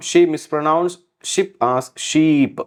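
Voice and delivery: She mispronounced ship as sheep, very slow; slow example read. Shown as a demonstration. Teacher reads the example sentence slowly to students. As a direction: dictate it word by word. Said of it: "slow example read".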